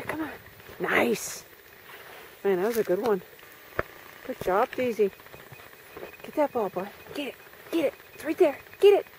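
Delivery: usual speaking voice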